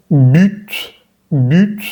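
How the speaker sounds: but as "büt", rounded ee and released t; careful French but with ü. Shown as a demonstration. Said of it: "Lo vemos en la palabra".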